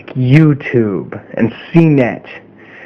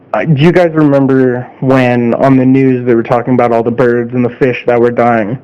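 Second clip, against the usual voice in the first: ordinary delivery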